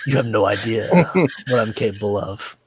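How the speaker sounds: low, soft celebrity impression; hushed, halting impression of a film star; Christopher Walken. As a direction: drop down low and quiet, pausing mid-sentence. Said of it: "Like Christopher Walken".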